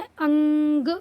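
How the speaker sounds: अंग with a loud, long nasal hum before ग; drawn-out anusvara. Shown as a demonstration. Teacher answering how the short sign sounds, held nasal. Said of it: "छोटी अंग का उच्चारण क्या".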